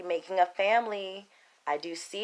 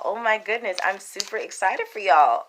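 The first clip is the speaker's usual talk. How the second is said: normal talking voice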